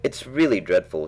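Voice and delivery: ordinary delivery, nothing marked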